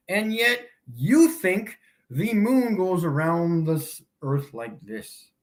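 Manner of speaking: ordinary talk, nothing marked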